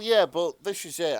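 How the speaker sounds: ordinary talk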